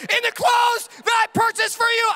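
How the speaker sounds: high, pompous tirade; dad ranting at his toddler over milk; mock rant. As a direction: puff up with righteous outrage.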